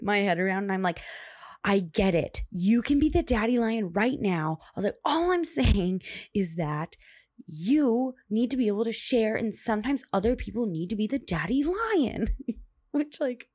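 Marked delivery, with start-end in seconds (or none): 1.6-4.6 exasperated parent, louder and higher; raised voice. Retold or imitated. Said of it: "raising my voice to my almost three-year-old". 5.0-12.3 exasperated parent climbing higher as they argue; raised voice. Retold or imitated. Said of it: "raising my voice to my almost three-year-old".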